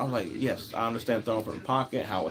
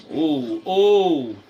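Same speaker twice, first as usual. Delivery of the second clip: excited ooh; 3 semitones higher, 6 dB louder, much slower than usual. Real, not acted. Reacting to a near interception.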